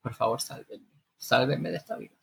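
small, soft cry for help; mock desperate plea. Housewife begging to be rescued from this life, soft mock plea. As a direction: plead weakly, well below normal volume.

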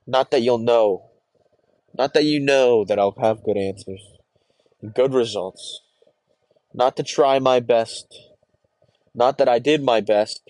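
loud, bitter outburst. Acted. Lashing out at those who only expect results, a loud bitter outburst. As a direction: hurl each no-longer-said thing at them.

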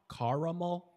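caramel as CAR-uh-mul, loud and high; three-syllable ah caramel. Shown as a demonstration. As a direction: say caramel with "ah" and a middle beat. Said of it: "How many syllables?".

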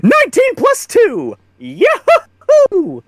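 high-pitched whoop of joy; real excited celebration; triumphant cheer. Real, not acted. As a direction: cheer the winning dice roll. Player celebrates a high roll.

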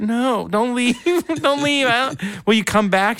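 high, desperate mock plea; mock begging. Begging the strippers not to leave, comically pleading.

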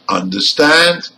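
"understand" with the second part, STAND, strongest; stress on STAND. Shown as a demonstration. Teacher gives a compound verb example, "understand" stressed on STAND. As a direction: keep under light and hit STAND in "understand". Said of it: "stand in number one".

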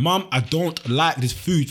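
slow, spelled-out protest to mum; deliberate, heavily paced refusal; emphatic complaint. Retold or imitated. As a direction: drag out each word to make the point. Retold plea to mum, slowed right down.

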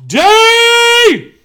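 loud, high show opener; shouted intro. Acted. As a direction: belt out the show greeting.

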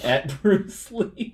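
laughing through the words. Real, not acted. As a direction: break into laughter mid-phrase. Laughing while describing dogs tossed.

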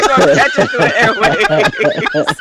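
squealing with laughter; words break into high laughter; laughing. Real, not acted. Laughing through joke.

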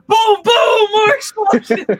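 loud, slow, laughing shout on the booms; mock explosion shout. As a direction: yell the booms like a hammed-up action trailer. Mocking an explosion-heavy pitch.

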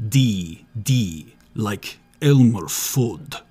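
ordinary talk

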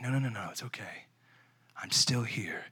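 hushed whisper; whispered reassurance. Pretend. God whispering comfort to the abandoned, hushed. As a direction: whisper it close like to a child. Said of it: "He's looking at you saying".

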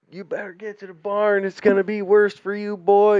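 a lightly voiced angry auntie threatening a kid. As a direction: chase the boy down with a threat. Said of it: "she's, you know".

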